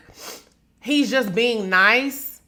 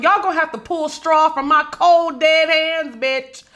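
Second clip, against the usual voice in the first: defiant; 4 semitones higher than usual. Real, not acted. Defiant vow to her audience.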